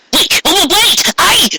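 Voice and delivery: high, hurried Trixie voice; startled plea. Acted. Trixie pinned against the wall, high startled stammer. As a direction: stammer it out, caught off guard.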